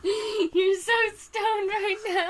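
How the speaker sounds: giggling while talking; laughing. Real, not acted. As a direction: laugh through the words. Laughing at stoned partner.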